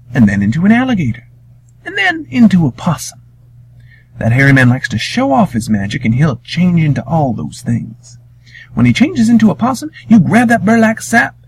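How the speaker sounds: lightly voiced grandmother plotting; grandmama voice. Grandmama telling Wiley how to trick the monster.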